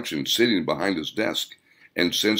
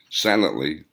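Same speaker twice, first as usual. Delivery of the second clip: ordinary delivery, nothing marked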